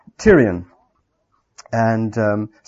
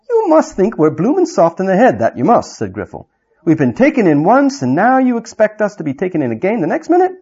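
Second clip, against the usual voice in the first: higher, louder coarse dwarf voice; mocking dwarf; 6 semitones higher, 4 dB louder than usual. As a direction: sneer at the fools. Griffle mocks king.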